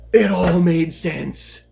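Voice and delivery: loud, slow, drawn-out dawning; sudden realization. Retold or imitated. Fighter learning his opponent is a lefty, sudden realization. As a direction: stretch it out as the revelation lands.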